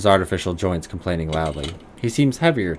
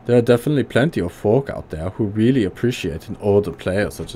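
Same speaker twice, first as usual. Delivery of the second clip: put-on gruff German accent, pitched higher; 3 semitones higher than usual. Old tinkerer greeting the player in a higher gruff German accent. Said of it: "high German voice".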